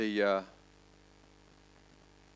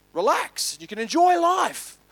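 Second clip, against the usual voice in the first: higher and louder, selling the dream; eager sales pitch; 14 semitones higher, 6 dB louder, slower than usual. Retold or imitated. Industrialist promises the fisherman a relaxed life, eagerly. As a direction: build to the payoff with relish.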